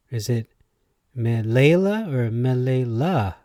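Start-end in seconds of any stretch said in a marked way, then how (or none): 1.2-3.3 "Melela" as meh-LAY-la, then meh-lay-LAH; shifting syllable stress. Shown as a demonstration. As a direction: slowly move stress in "Melela" from middle to last. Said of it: "put a different emphasis on words".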